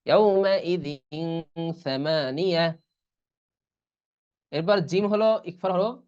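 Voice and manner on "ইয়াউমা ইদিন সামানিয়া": slow, stretched recital; Quran recitation. Shown as a demonstration. Teacher recites the example with ikhfa slowly. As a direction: draw out each syllable. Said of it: "এরকম আর কি".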